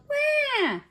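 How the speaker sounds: put-on high, loud baby wail; baby's yell. A narrator's reading. Baby ghost woken by the shouting, high loud wail. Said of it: "waked up with all this noise and yelled".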